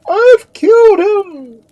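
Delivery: piercingly high, slow, frantic cry; loud shriek. A narrator's reading. Duke answering all questions with a shriek. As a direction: shriek it at full force. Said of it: "shrieked the Duke, in answer to all".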